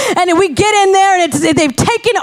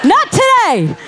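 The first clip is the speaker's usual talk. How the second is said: defiant cry; 4 semitones higher, slower than usual. Real, not acted. Preacher defies the dark side before the congregation, shouting. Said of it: "the dark side was not going".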